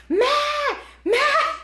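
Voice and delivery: high, loud call across the house; urgent loud call up high. Retold or imitated. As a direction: yell for your husband to come. Calling husband from the shower.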